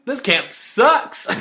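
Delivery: slow, high, loud kid imitation; frustrated kid outburst. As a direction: imitate a child's protest, stretching it out. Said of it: "he just is real frustrated".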